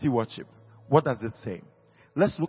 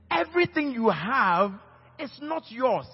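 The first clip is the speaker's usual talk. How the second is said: loud, high, forceful preaching; 8 semitones higher, 5 dB louder than usual. Real, not acted. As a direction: hammer it home to the congregation.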